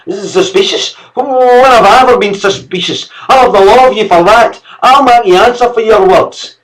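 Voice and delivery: high, loud indignant shouting; outraged servant. Acted. Servant shouting threats of law at the master. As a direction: rage at the insult.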